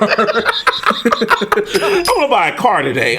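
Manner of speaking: slow, drawn-out daydreaming voice with a laugh; mock dreamy coach. Coach shopping for a car instead of watching film, slow mocking voice. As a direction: stretch it out like a coach lost in a daydream. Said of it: "He was like".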